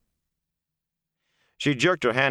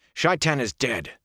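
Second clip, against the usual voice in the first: harsh and higher, drawn out slowly; 7 semitones higher, 3 dB louder, slower than usual. A narrator's reading. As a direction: cut her off with a harsh, slow retort. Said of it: "he said harshly".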